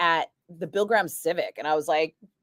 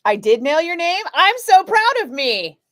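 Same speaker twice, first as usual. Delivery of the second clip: proud of himself; 10 semitones higher, 6 dB louder than usual. Real, not acted. Host proud he pronounced caller's name, high and gleeful. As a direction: gloat playfully about nailing a name. Pleased at saying name right.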